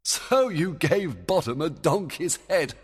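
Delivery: high, loud, amused question; delighted, laughing disbelief. Acted. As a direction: ask with a chuckle ready to break out. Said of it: "Oberon laughed".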